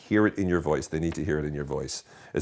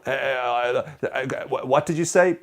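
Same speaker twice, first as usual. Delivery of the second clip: higher-pitched stammering, struggling to get a word in; stammer, then blurt a bewildered question; flustered cut-off customer; 7 semitones higher, 4 dB louder than usual. Acted. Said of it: "They'll be, like, going".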